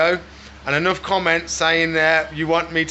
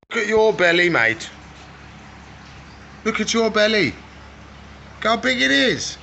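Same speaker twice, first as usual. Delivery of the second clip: teasing pet talk; 5 semitones higher than usual. Real, not acted.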